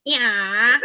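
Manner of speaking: mock Mancunian drawn-out yeah; Manc accent. Shown as a demonstration. Speaker shows off a proper Manc voice to the group, Manc accent. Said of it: "in a proper manky voice".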